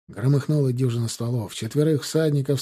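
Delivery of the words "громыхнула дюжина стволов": ordinary delivery, nothing marked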